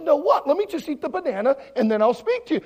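baffled protest. Retold or imitated. Pastor arguing with screaming kids about banana, baffled. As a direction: push back, loud and puzzled.

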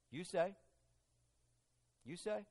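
soft, noncommittal, rising at the end; open-ended answer. Retold or imitated. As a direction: leave it hanging quietly, deliberately ambiguous. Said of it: "He keeps it kind of an open-ended answer".

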